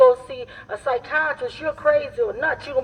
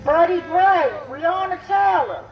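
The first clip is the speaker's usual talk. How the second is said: loud calling names; 7 semitones higher, 4 dB louder than usual. Real, not acted.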